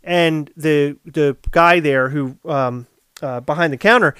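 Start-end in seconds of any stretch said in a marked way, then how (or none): none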